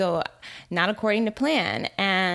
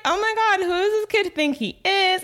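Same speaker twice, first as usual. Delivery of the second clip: mock indignant; 13 semitones higher, 4 dB louder than usual. Indignant inner reaction to kid.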